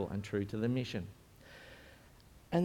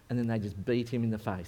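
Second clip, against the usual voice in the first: ordinary talk, nothing marked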